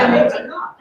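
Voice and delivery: said through laughter; laughing. Real, not acted. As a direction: still laughing as you speak.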